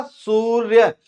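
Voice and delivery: सूर्य slow, "sooor-yay" with both parts held; slowly sounded out. Shown as a demonstration. Teacher proving which sound comes out, सूर्य drawn out. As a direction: stretch सूर्य to expose the final "ya" sound. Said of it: "यही तो निकलेगा".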